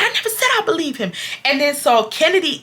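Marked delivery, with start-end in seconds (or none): 0.0-1.1 high, rapid mock denial; squeaky, hurried self-defense; defensive protest. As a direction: imitate a flustered man denying it. Manager defending himself in the quarrel.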